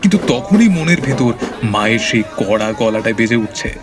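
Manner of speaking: ordinary talk, nothing marked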